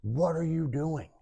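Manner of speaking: mock a horrified scream at contained volume; retold scream. Wife finding her husband on the bucket, restrained high scream. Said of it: "she screams".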